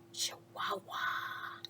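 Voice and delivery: soft, slow whispered secret; hushed whisper. A narrator's reading. Narrator to listeners, a whispered secret. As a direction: breathe it like a secret.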